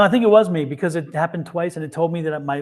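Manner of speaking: usual speaking voice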